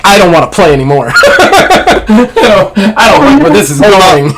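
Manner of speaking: high, fast, laughing protest; mock-pleading player laughing at the twist; laughing whine. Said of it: "Jackie's like".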